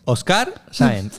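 slow, high Argentine "Oscar Sáenz"; mock Argentine accent. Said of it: "En Argentina se pronuncia Oscar". (acento argentino exagerado y lento)

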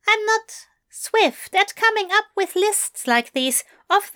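a prim bookish angel confessing lightly; Aziraphale voice. Acted. Aziraphale's voice in dialogue.

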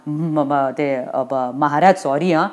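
soft, low, faltering apology; cowed servant fumbling for words; nervous stammer. Acted. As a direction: stutter meekly before your master. Doorkeeper apologises to angry Yamraj.

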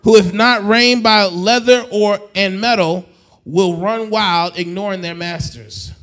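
loud, driving preacher's plea; full-force preaching. Acted. Pastor pleading with the congregation, preaching loud. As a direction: lift it up and push the congregation.

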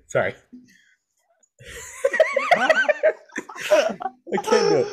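cracking up, high-pitched and helpless; laughing. Real, not acted. Podcaster breaking down mid-impression, laughing helplessly. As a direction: give up through real laughter.

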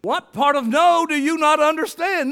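loud, high preacher's cry; full-force preaching. Acted. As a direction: preach it out with fire to the congregation.

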